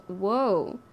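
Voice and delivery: ordinary talk, nothing marked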